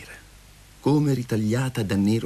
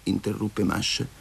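ordinary delivery, nothing marked

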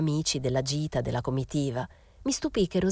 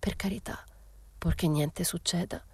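soft, halting, whispery plea; stammered; 2 semitones higher, 7 dB quieter than usual. A narrator's reading. The mother to Momina about Rosetta, faltering softly. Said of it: "Balbettò".

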